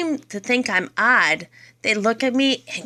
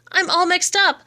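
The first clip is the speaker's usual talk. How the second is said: second "up" pitched high and falling, hammed-up bewilderment; mixed-up wail; 9 semitones higher than usual. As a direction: repeat it much higher, a bewildered wail. Poem refrain, said twice.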